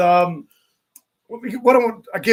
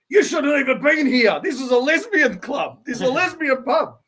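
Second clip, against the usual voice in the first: imitating her, higher and louder, indignant; angry woman; 7 semitones higher, 4 dB louder than usual. Lady at the pub telling the blokes off, angry and higher. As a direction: play the riled-up lady telling them off. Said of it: "the lady starts getting angry".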